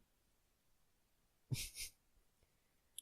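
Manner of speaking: whispered giggle fit; breaking into laughter again. Real, not acted. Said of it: "just try again".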